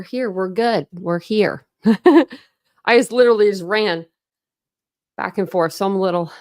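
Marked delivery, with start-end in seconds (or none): 1.9-6.3 slightly out of breath after running. Real, not acted. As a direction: talk while catching your breath. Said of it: "little out of breath".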